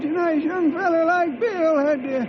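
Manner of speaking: put-on high old cowhand voice, wry pity; mock-mournful old sidekick. Sidekick joking to friends that Bill cracked up, high and wry. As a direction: shake your head over him in a high old-timer voice.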